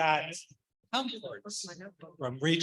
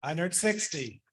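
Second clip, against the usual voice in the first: brisk auction bid calling; auctioneer; 7 dB louder than usual. Acted. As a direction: call the bids like an auctioneer. Calls bids at auction.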